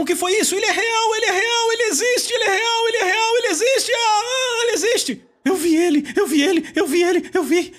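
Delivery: very high, crying out in overwhelming joy; ecstatic shouting. Retold or imitated. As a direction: shout it like a man who just saw Jesus, voice breaking. Converted man shouting in ecstasy.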